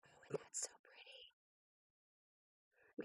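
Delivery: breathy hushed whisper of admiration; soft awed whisper. Real, not acted. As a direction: whisper it as if in awe. Admiring stickers softly.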